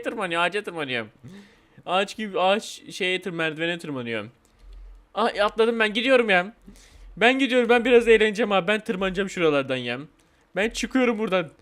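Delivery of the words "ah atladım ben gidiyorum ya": normal talking voice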